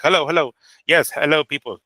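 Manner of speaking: lightly voiced, stilted hello greeting; a self-admittedly pathetic take on a recorded greeting; Turki Al Sheikh impression. Host mocking Turki Al Sheikh's canned greeting, lightly voiced. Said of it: "my pathetic, uh, Turkey impersonation".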